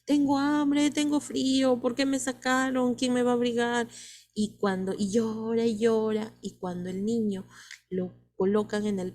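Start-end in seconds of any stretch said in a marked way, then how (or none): 0.1-3.9 a lightly voiced crying baby, a bit higher; teacher half-acts a newborn pleading from inside its cry; newborn's complaint. Teacher voicing a newborn's cry for class, mildly higher and pleading. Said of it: "dentro de su llanto dice".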